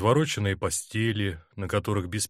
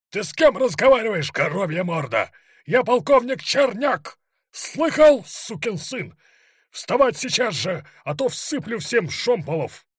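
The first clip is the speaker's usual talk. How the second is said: high-pitched furious screaming; boundless rage; 12 semitones higher, 6 dB louder than usual. A narrator's reading. Colonel threatening the Cossacks with ramrods, raging shout. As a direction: scream threats at an insolent subordinate. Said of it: "Его ярости не было границ".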